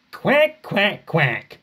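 high mocking duck quacks; mock quacking. Donald teases Duck with duck quacks.

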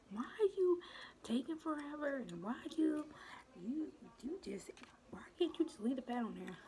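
high-pitched nagging mom voice; mom's nagging voice. Retold or imitated. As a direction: whine it like an impatient mom. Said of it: "my mom should always be like".

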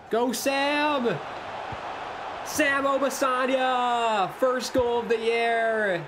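roaring the scorer's name; goal celebration shout. Real, not acted.

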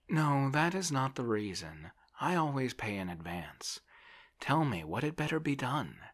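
high, fretful character voice; plaintive landowner. A narrator's reading. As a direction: play a helpless man begging for advice. Voices Oblomov appealing.

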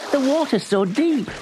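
high worried voice; fearful. A narrator's reading. Billy fearing for his pony, high and worried.

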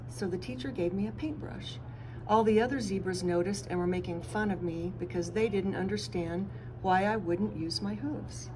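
ordinary delivery

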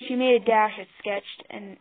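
ordinary delivery

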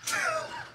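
squealing laugh; high burst of laughter. Real, not acted.

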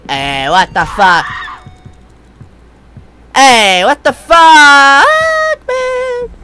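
high, loud frightened yell; gasp, then shriek in panic; scared horror game streamer. Real, not acted. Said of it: "I'm getting kinda scared".

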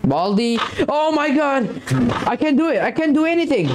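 high-pitched panicked cry; real panic. Real, not acted. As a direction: cry out helplessly as he closes in. Game villain closes in.

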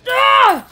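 a loud, high short scream. Real, not acted. Streamer frightened by the game, high short scream. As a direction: let out a sudden fright cry.

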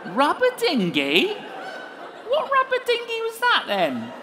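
high, loud comic disbelief; mock bafflement. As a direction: demand it as if utterly puzzled. Mock puzzled reply to tongues.

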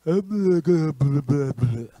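imitating slurred drunken gibberish; garbled drunk mumbo-jumbo, words lost; drunk rubbish. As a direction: babble like a hopelessly drunk neighbor. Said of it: "he's talking like rubbish because he was so drunk".

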